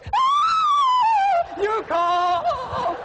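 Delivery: very high squeal into drawn-out words; mock delighted wife. Wife gets an unexpected call from her husband, high hammed-up delighted squeal. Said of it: "I could hear her. She goes".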